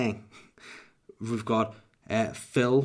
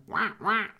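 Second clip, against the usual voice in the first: mock duck quack; 8 semitones higher than usual. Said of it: "he makes a quack when he talks".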